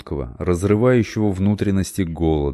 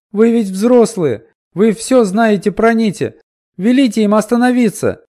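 put-on child begging; 8 dB louder than usual. A narrator's reading. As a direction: beg the grown-ups at full voice. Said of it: "упрашивала она".

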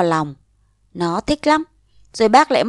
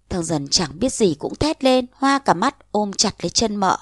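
ordinary delivery

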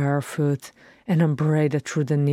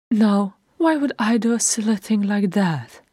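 higher-pitched, kindly reassurance; gentle; 6 semitones higher, 3 dB louder than usual. A narrator's reading. Bilbo reassuring Thorin he'll stay, gentle high voice. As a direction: soothe with a light, fond scolding. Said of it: "he said gently".

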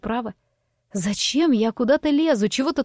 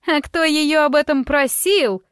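higher and louder, drawn-out slowly shrieked question; shrill shriek; 5 semitones higher, 4 dB louder than usual. A narrator's reading. The scandal-maker shrieks at the crowd, shrill and slow. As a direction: shriek it with indignation. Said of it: "взвизгнула госпожа Норгентаундон".

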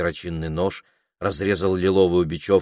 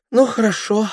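sighing; 5 dB louder than usual. Acted. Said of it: "вздохнула Дульчебелла".

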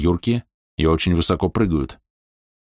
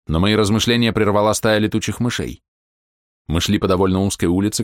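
usual speaking voice

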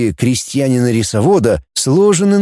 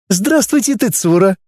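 chirped much higher; a high, quick chirp of a greeting; 7 semitones higher than usual. Acted. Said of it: "прощебетала Фумико".

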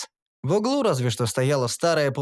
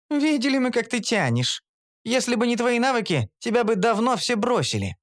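higher, mocking female voice; sarcastic Kara; 9 semitones higher than usual. A narrator's reading. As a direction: scoff at his boasting. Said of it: "Кара". (Кара язвительно, высоким голосом)

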